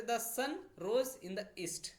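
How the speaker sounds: slow example sentence; slow dictation read. Shown as a demonstration. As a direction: read slowly for note taking. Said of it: "सूर्य क्या है".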